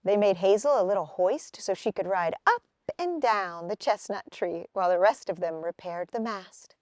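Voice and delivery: usual speaking voice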